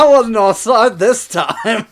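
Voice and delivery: put-on slow, loud Australian accent, laughing through it; hammy Australian accent read slowly. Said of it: "an Australian accent".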